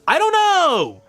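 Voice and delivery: very high-pitched frustrated wail; exasperated outburst. Real, not acted. Fan fed up with a muddled plot, exasperated high wail. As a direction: burst out high, demanding an explanation.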